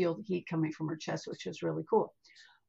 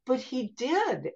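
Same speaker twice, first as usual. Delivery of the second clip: slow, higher-pitched innocent protest; naive insistence; 5 semitones higher, slower than usual. Retold or imitated. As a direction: insist innocently, drawing it out slowly. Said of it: "I was just so naive".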